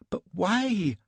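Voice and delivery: put-on high-pitched, petulant, breathless young lady; a shaken, indignant society wife; Elsbeth, fluttery young wife. A narrator's reading.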